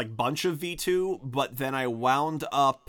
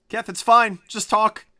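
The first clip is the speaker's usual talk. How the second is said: high, louder coaxing; louder urging; 9 semitones higher, 6 dB louder than usual. Real, not acted. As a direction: coax him loudly to talk. Urging silent caller to speak.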